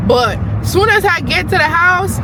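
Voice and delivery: they are crazy, hit it hard; excited rant. Real, not acted. Excited rant about nail people.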